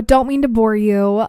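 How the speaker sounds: ordinary talk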